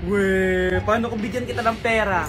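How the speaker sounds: put-on a mother baiting her child with cash; teasing mom. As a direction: dangle the money slyly. Mom tempts child with money.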